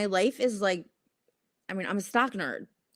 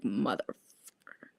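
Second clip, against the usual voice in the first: drawn-out disgusted curse; 8 semitones lower, 6 dB quieter, much slower than usual. Retold or imitated.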